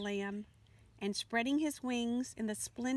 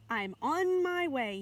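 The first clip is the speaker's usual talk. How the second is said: slow, louder, higher call; cried out; 5 semitones higher, 5 dB louder, slower than usual. A narrator's reading. Butterfly calls to the lamb while flying away, slow and louder. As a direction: call it out as you fly off. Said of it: "he cried".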